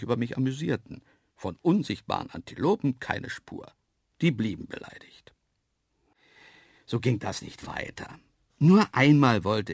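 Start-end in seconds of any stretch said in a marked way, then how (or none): none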